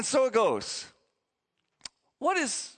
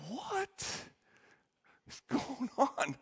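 hushed disbelief; 8 semitones higher, 9 dB quieter, much slower than usual. Retold or imitated. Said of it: "everyone's just like".

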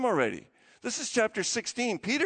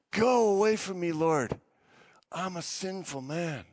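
freaked out, pleading; 3 semitones lower than usual. Retold or imitated. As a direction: beg as if overwhelmed, stretching the words. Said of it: "freaked out".

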